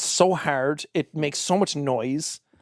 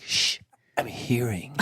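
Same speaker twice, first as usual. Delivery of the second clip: a hint of a lower, slow hushing voice; shushing ear; 6 semitones lower, 4 dB quieter, slower than usual. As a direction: shush them like a fussy little ear.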